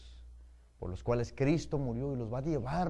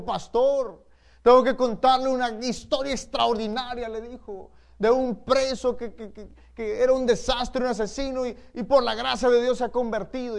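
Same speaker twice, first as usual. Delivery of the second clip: high, loud, eager telling of news; excited report; 8 semitones higher, 8 dB louder than usual. Retold or imitated. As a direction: burst out with amazing news. Church member excitedly reports conversion.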